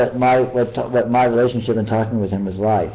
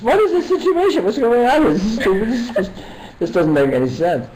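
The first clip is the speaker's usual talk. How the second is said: much higher, incredulous mock whine; baffled mock exasperation; 13 semitones higher than usual. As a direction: play up the bafflement, pitched way up. Baffled reaction, retold.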